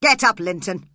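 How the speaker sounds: furious father shouting; angry shout. A narrator's reading. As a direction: snap at your son. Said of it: "He shouted angrily to his son".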